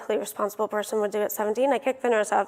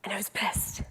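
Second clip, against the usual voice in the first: whispered confession; 3 semitones lower, 11 dB quieter than usual. Real, not acted. Recalling her anger.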